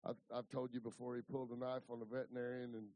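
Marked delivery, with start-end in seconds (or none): none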